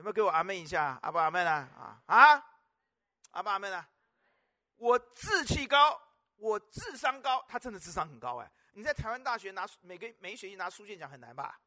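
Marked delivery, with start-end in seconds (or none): 4.8-7.4 drawn-out slow declaration; slow emphasis. Acted. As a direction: spell out each word for the hall. Preacher repeats key words slowly.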